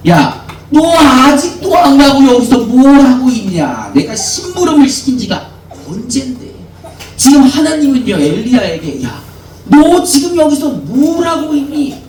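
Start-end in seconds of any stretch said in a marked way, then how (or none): 0.1-6.4 scolding parent. Retold or imitated. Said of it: "뭐라 그러세요?". 9.0-12.0 higher, stern rebuke; rebuking God. Acted. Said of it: "책망을 하고 있는 겁니다".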